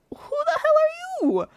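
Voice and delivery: indignant high-pitched disbelief; shocked outrage. Retold or imitated. To the man who smacked my arm, high shocked outrage. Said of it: "and I'm like".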